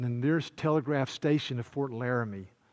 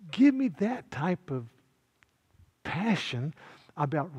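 full-force preaching; 7 semitones higher than usual. Acted. Pastor to congregation about Phillips's ride, impassioned preaching. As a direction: preach it with rising fire.

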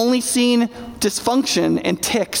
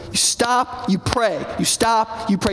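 usual speaking voice

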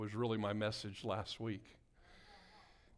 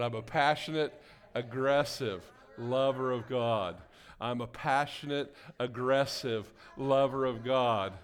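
like you mean it; 8 dB louder than usual. Shown as a demonstration. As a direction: belt it out emphatically, stretching the last words. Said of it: "say it like you mean it".

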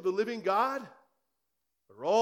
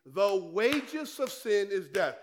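slow, every word weighed; a preacher pressing scripture home; slow emphatic quote; slower than usual. Real, not acted. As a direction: lay out "The wages of sin is death" slowly and firmly. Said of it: "The Bible says in Romans six twenty-three".

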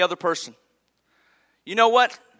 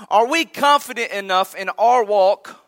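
usual speaking voice

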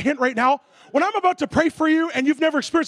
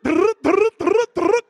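buzzing drrrt sounds, a bit louder; imitated teeth chatter; 3 semitones higher, 4 dB louder, much slower than usual. Preacher acts out the guy's teeth rattling, buzzing mock drrrt. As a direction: rattle it out like teeth on a live wire.

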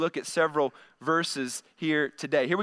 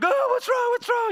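much higher, louder alarmed wife's voice; startled wife; 3 dB louder than usual. Retold or imitated. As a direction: play the jolted-awake wife asking what's wrong. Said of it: "Micah's like".

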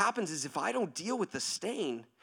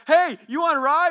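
high, loud friendly shout; calling out; 9 semitones higher, 13 dB louder than usual. Acted. Driver offering friend a ride, loud eager call.

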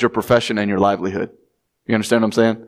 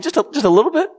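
high mocking question; tiny tidbit; 13 semitones higher, much faster than usual. Shown as a demonstration. Preacher mimicking the stranger, high mocking voice. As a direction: squeak out a little bit, belittling. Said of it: "Any means a tidbit".

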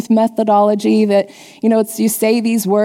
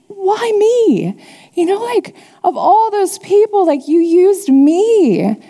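high-pitched, wondering disbelief; raised pitch, slowing on the last words; astonished; 8 semitones higher, slower than usual. Retold or imitated. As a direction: retell being amazed at being chosen. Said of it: "I was pretty astonished that God used me".

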